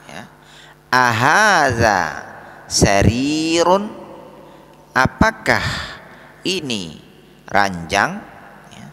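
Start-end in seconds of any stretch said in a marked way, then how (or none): 0.9-3.9 Ahadza baitun drawn out slowly with a rising question; teacher's slow demo question; slow model reading. Shown as a demonstration. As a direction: model the Arabic question slowly for students to repeat. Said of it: "pakai kata tanya a".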